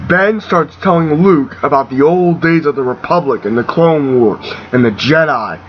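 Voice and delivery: ordinary delivery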